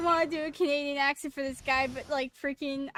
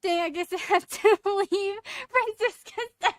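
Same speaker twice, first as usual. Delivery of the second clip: laughing; 5 semitones higher, 6 dB louder than usual. Real, not acted. Laughing while voicing the character.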